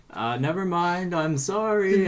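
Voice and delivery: embarrassed. Acted. Beryl apologizes to the dwarf after her lie fails, embarrassed. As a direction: back off awkwardly, flustered. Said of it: "Beryl will look embarrassed and be like".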